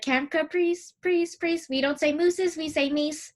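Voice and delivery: high, lightly acted cartoon quote; a hint of a cartoon girl voice; cartoon character voice. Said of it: "from As Told by Ginger".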